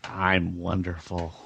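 slow, soft and seductive; sexy tone. Acted. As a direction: stretch it out, soft and suggestive. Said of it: "Why are you suddenly sounding sexy".

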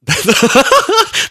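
squealing high laugh; high giggle. Retold or imitated. Podcaster voicing a fan's giggle, loud high squeal. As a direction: burst into a loud girlish giggle. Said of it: "That's my listener's voice".

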